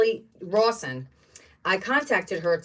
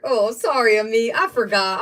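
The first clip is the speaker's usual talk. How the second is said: normal talking voice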